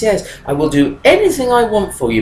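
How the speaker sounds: usual speaking voice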